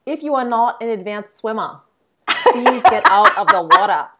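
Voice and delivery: a laughing Australian lifeguard warning, slowing and rising; Australian accent. Pretend. Host asked to try her Australian accent, laughing through it. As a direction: put on an Aussie beach announcement, cracking up. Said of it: "try your accent".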